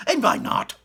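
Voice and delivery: high, loud whispered demand; high loud breathy challenge; demanding mother. Acted. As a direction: demand hard. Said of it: "asked Missus Gloop".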